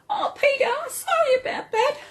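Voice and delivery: cackling bird laugh, high and wild; kookaburra laugh. Shown as a demonstration. Imitating a kookaburra laugh for her viewers, high cackle. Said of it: "That's similar to a kookaburra's laugh".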